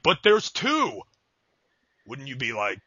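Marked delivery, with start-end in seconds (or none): 0.0-1.0 high, mock-cheerful selling; upbeat spin. Host defends bad pizzas to his date, mock upbeat. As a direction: pitch it up like a feeble sales spin. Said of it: "And I was like".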